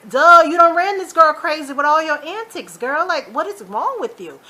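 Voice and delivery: loud, high-pitched telling-off; fed-up berating; exasperated scolding. Retold or imitated. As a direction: scold with full sass, starting loud and easing off. Scolding Veronica in imagined reply.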